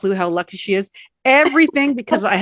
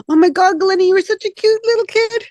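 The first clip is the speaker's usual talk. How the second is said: put-on much higher, gushing over her little girl; gushing mom; 9 semitones higher than usual. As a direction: coo at your grown daughter like she's tiny. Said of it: "I'll break out into tears".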